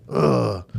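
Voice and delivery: imitated groan of frustration; frustrated groan. Son struggling with the Legos, imitated frustrated groan. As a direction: groan like a kid stuck on a task. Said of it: "getting frustrated".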